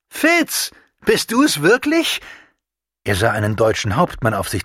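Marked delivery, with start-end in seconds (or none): 0.1-2.2 Fitz, sehr hoch und laut gerufen; freudiger Ruf. A narrator's reading. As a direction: ruft den alten Freund quer übers Feld. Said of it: "wie sein Name gerufen wurde". (sehr hoch und lauter Ruf)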